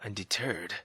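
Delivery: usual speaking voice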